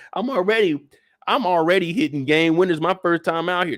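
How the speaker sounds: normal talking voice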